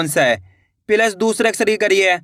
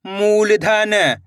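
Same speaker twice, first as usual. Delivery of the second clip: syllables joined into one slow word; blended word; much slower than usual. Shown as a demonstration. Teacher joining the syllables for learners, slowly blended. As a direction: read it as one whole, stretched word. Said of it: "तो मिलके बन जाएगा".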